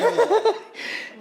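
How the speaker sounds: sudden high laugh; high burst of laughter. Real, not acted. Laughs during the setup mix-up.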